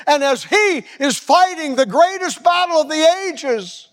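fiery preacher pleading with the congregation; preaching climax. Real, not acted. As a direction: build to a thundering peak. Preacher climaxes at full force.

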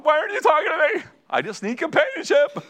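high-pitched pleading, hammed up; comic, plaintive squeal; mock needy whine. Mock whining for companionship.

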